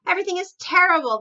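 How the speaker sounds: high, loud, rushed cry of a king in a panic; a frantic king blurting out bad news; panicked king. Panicked King of Sweden on phone.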